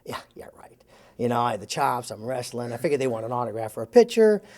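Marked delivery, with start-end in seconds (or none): 0.0-0.8 breathy whispered yeah-right, no voice; sarcastic whisper. Retold or imitated. To himself, doubting the fans want autographs: sarcastic whisper. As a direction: whisper it like a scoffing inner thought.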